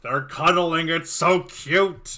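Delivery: loud, drawn-out delighted gush. Real, not acted. Game master reacts to two characters cuddling, gushing. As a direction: gush over something adorable, stretching it out.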